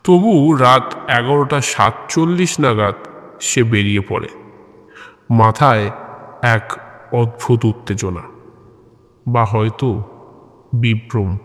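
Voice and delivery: ordinary delivery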